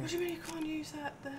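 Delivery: usual speaking voice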